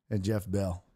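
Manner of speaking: normal talking voice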